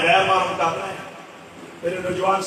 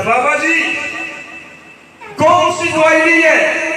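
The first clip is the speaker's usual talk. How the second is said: put-on raised pitch, calling to a hard-of-hearing old man; loud question to deaf elder; 7 semitones higher, 3 dB louder, slower than usual. Visitor calling to a deaf elder, raised voice. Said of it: "انہوں نے اونچا پوچھا".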